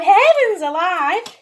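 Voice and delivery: loud, slow cry of dismay; exclaiming. Acted. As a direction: cry out in exasperation. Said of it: "The little old lady cried".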